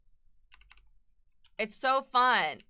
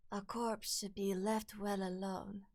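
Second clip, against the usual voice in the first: soft, slow character voice; Lady Maria; 7 dB quieter, slower than usual. Acted. Streamer attempting a game character's line, soft slow delivery. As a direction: say it softly and slowly, in character. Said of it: "when I try to do Lady Maria".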